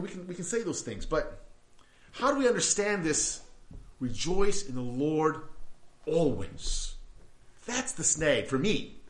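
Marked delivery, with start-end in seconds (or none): none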